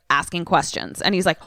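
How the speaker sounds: ordinary talk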